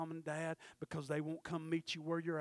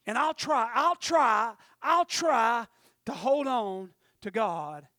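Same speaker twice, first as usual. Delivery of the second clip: mock pleading shout; 5 semitones higher, 13 dB louder, slower than usual. Voicing wavering believer.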